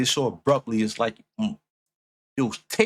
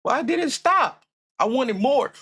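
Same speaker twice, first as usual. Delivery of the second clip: much higher, real protest that the song stopped; indignant outburst; 13 semitones higher than usual. Real, not acted. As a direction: cry out why it stopped, pitch way up. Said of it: "Mm, I'm mad now".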